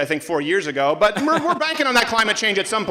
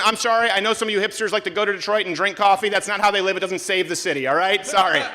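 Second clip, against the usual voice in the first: normal talking voice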